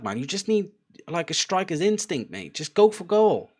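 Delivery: high, loud exasperated shouting at the screen; frustrated yelling. Real, not acted. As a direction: urge him on like a fed-up fan. Yelling advice at player on TV.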